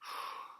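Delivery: breathy, weary sigh; heavy sigh. A narrator's reading. Walrus watches Turtle on the ground, sighing.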